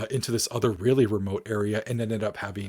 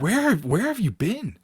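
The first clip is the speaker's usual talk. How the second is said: mock-angry demand, higher and slow; indignant, drawn-out question at raised pitch; retold anger; 9 semitones higher than usual. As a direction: imitate an upset boyfriend demanding an answer. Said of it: "like, angry".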